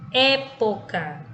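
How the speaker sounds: "Época" slowly, with the opening É punched; stress on first syllable. Shown as a demonstration. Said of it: "pronunciamos o E com mais intensidade".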